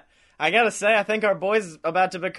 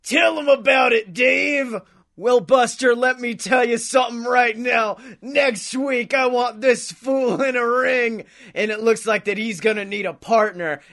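wrestling promo shout; 4 semitones higher than usual. Retold or imitated. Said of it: "is just like".

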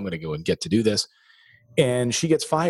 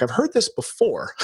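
wry disbelief; 11 semitones higher than usual. Retold or imitated. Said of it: "I'm like".

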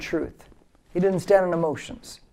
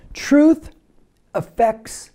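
call-and-repeat slogan; 3 semitones higher, 4 dB louder, slower than usual. Shown as a demonstration. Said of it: "Say that with me out there".

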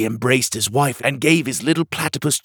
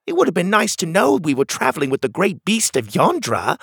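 higher-pitched character voice, mock reproach; pitched-up, playful complaint; teasing Cogleen; 7 semitones higher, 3 dB louder than usual. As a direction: playfully scold a travel companion.

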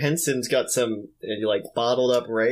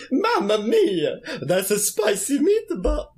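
hammed-up Italian accent, higher and slow; mock Italian accent; 9 semitones higher, slower than usual. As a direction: stretch it out like a cartoon Italian chef. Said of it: "They're like".

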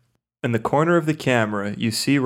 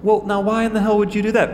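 indignant; 9 semitones higher, faster than usual. Acted. Blazin scolding partner.

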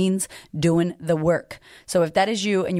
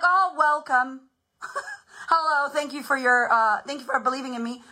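hammed-up hostess welcome, pitched up; mock gracious welcome; 5 semitones higher than usual. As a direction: gush thanks in a high put-on voice. Acting out greeting the first buyer.

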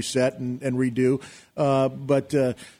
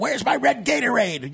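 high, slow whiny diva demand; diva yelling; 11 semitones higher than usual. Retold or imitated. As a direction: play a spoiled star barking for a drink. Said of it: "yelling at everyone".

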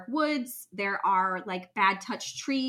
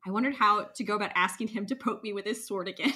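normal talking voice

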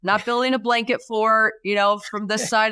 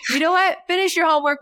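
mom brushing it off; 9 semitones higher, faster than usual. Retold or imitated. Mom cutting off son.

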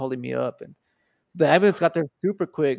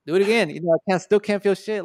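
urgent plea; 5 semitones higher, 6 dB louder than usual. Retold or imitated. Begging friend to slap again.

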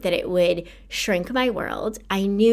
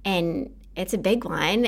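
usual speaking voice

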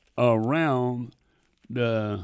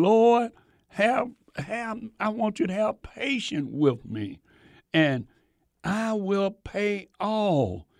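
pleading servant; 6 semitones higher than usual. Acted. Servant begging his lord.

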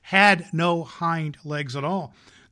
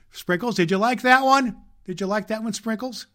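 playful question; 8 semitones higher, 6 dB louder than usual. Real, not acted. Asking the elf Sprinkles.

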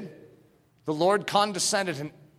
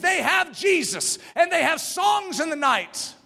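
preaching at full force; 10 semitones higher than usual. Acted. Preacher rousing the congregation about martyrs, shouting. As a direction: preach it with fire to the rafters.

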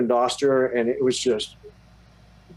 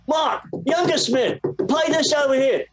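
put-on English accent; 12 semitones higher than usual. English producer to younger brother in the studio, high English accent. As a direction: impersonate an English producer giving orders. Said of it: "in his English accent".

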